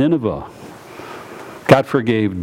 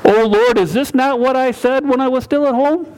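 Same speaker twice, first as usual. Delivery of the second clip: Jonah praying; 12 semitones higher than usual. Acted. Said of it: "Jonah prayed that".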